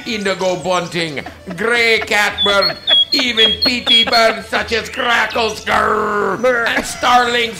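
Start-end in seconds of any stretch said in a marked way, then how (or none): 1.6-7.6 high, loud playful growling at pest birds; mock growl of disgust. Real, not acted. Reading her list, growling at the grackles. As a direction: grrr at the grackles like a cartoon villain.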